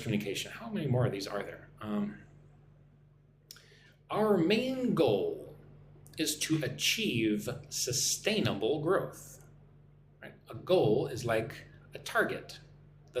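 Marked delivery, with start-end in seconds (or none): 4.1-9.4 slow model reading. Shown as a demonstration. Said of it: "Listen and repeat after me".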